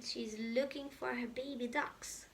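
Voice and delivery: ordinary delivery, nothing marked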